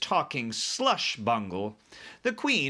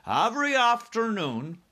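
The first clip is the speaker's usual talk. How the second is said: high, loud giant voice; BFG explaining; 11 semitones higher, 5 dB louder than usual. A narrator's reading. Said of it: "the B F G said".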